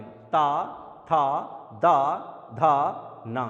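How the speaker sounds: ता था दा धा ना with the tongue against the teeth; dental syllables shown. Shown as a demonstration. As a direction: tap the teeth on ता था दा धा ना. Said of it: "आप खुद बोल के देख लीजिए".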